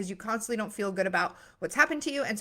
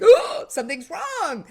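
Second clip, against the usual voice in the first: high, loud, slowed alarmed realisation; baby's alarm; 7 semitones higher, 9 dB louder than usual. Retold or imitated. Baby reacting to the adults' fuss, mock alarm much higher. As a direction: voice the baby suddenly frightened. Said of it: "the baby is like".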